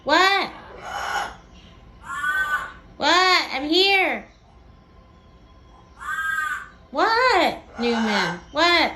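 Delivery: loud repeated calls of What; calling out. Real, not acted. Calling back to Newman, loud and repeated. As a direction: shout it across the room.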